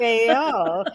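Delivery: Beo drawn out into three syllables, loud. Retold or imitated. Imitating the lady on the bus stretching Beo out. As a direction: roll Beo round like marbles. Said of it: "like it had three syllables".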